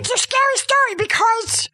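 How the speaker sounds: high, insistent protest; indignant insistence. Real, not acted. Child defending a scary story to Dad, indignant. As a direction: defend it hotly, pitch shooting up.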